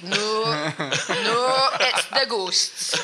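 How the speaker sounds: loud, drawn-out mock-spooky insistence; insistent mum. Imitating mum rejecting the alopecia diagnosis, loud spooky insistence. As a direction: stretch it out like a hammed-up ghost believer. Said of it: "my mum went".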